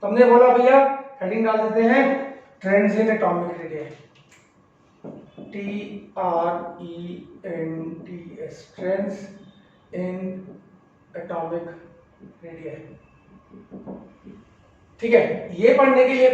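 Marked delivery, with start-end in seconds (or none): 2.6-12.8 letters spelled out softly and slowly; slow quiet dictation. Shown as a demonstration. Teacher to students, spelling out the heading quietly.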